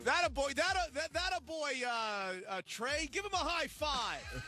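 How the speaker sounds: high-pitched, hammed-up encouragement; mock fan cheering. Imitating a fan praising a player, mock cheering. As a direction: cheer them on like a rowdy fan.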